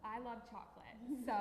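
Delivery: ordinary talk, nothing marked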